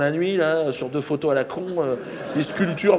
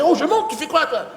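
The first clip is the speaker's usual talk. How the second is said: much higher, louder breezy voice; mock casual; 10 semitones higher, 5 dB louder than usual. Friend being sucked into the saucer, high loud breezy tone. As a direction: toss it off lightly.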